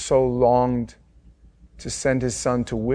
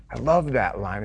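ordinary talk, nothing marked

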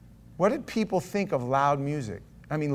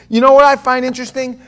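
loud, high rhetorical question; raised preacher's hook; preaching call; 9 semitones higher, 12 dB louder than usual. Real, not acted. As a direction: grab the room with it. Preacher calls out to congregation.

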